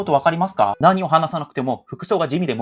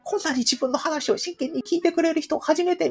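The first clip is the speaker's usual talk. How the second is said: smitten foreigner; 11 semitones higher than usual. Retold or imitated.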